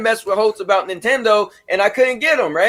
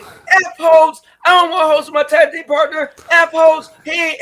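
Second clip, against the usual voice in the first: loud, high retold yell; mock angry shout; 9 semitones higher, 4 dB louder than usual. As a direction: bellow it like the furious friend refusing. Friend cursing, refusing tag partner.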